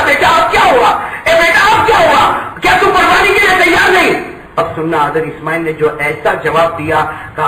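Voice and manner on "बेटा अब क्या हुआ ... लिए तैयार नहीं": a father shouting in grief; anguished cry. Acted. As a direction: cry out to the son in despair. Father cries out to his son.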